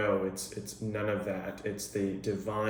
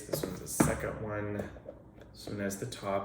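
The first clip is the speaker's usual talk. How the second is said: usual speaking voice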